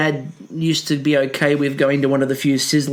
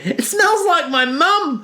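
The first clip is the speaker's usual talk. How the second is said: much higher, slow, laughing; high slow mock quote through a laugh; lightly voiced Mel, amused; quoting Mel; 11 semitones higher, slower than usual. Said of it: "as Mel said".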